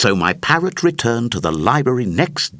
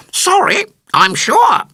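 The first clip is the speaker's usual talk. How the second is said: a parrot's apology, much higher and louder; ear-splitting squawk; 6 dB louder than usual. Acted. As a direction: screech the apology way up high. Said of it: "in an ear-splitting squawk".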